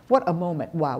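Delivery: ordinary talk, nothing marked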